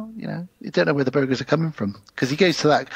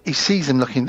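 ordinary delivery

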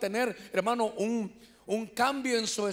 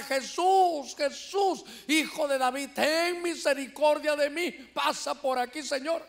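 raised, higher-pitched pleading call; imitated crying out; 4 semitones higher than usual. Blind beggar to passing Jesus, imitated crying out. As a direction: call out for mercy over a crowd. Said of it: "empieza a gritar".

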